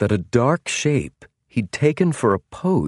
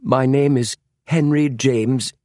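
higher, slow, strained answer; sudden panic; 6 semitones higher than usual. A narrator's reading. James answering the stranger's demand, in sudden panic. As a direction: answer high-pitched and uneasy. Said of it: "In his sudden panic".

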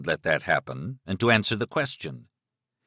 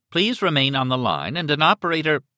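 robot voice; 7 semitones higher, 3 dB louder than usual. A narrator's reading. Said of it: "the robot voice was continuing".

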